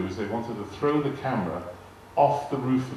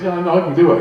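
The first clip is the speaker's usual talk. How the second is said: much higher and louder, fired back; loud retort; 8 semitones higher, 13 dB louder, faster than usual. Retold or imitated. Actor bristling at his director, loud and high. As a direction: push back hard, voice up.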